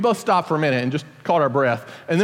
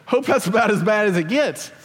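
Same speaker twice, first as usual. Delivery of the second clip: hopeful; 4 semitones higher than usual. Retold or imitated. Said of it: "I was like".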